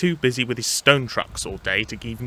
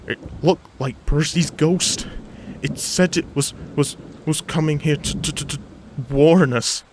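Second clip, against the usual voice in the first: higher, halting, stuttering fear; shaky voice; 4 semitones higher, 3 dB louder than usual. Acted. Toby pretending he saw Percy's ghost, shaky voice. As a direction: stammer it out as if terrified. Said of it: "said Toby in a shaky voice".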